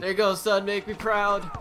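proud dad voice. Pretend. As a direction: cheer like a proud father. Mock proud dad praising son.

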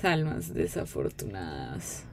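mustache twirling villain. Acted. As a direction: drag it out like a cartoon baddie. Repeats line in spooky villain voice. (voz grave de villano malvado)

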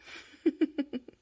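high, loud giggle; laughing. Real, not acted. Delighted by kittens at play, high laughing.

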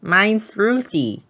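put-on a shy child's happy first introduction; smiling. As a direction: Ruthie smiling as she tells the girl her name.

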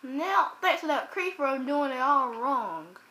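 ordinary talk

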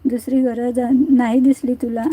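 usual speaking voice

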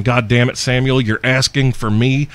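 normal talking voice

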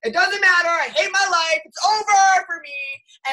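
hurried mock panic that climbs high and loud; hammed-up meltdown rising into a loud, high cry; mock despair building to a wail. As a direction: rush it, then wail it out like your career is over. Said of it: "I was like".

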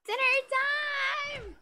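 very high, loud, long-drawn holler; hammed-up dinner call. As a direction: belt it out, stretching each word.

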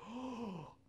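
big gasp into a high "oh"; gasp of realization. Acted. Preacher acting out the disciples' realization, awed gasp. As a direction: gasp as if it suddenly dawns on you.

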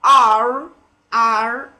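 strong American "R" with the r sounded. Shown as a demonstration. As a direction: punch "R" out with an American curled r. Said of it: "is in case of American accent".

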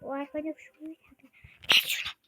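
ordinary talk

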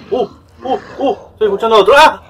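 high, loud, jumpy yelps; startled cries. Real, not acted. Player hearing another zombie close by, startled cries. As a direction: blurt it out in rising alarm.

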